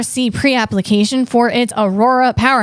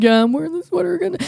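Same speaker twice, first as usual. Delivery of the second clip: mock Sam; 6 semitones higher, much faster than usual. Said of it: "He's like".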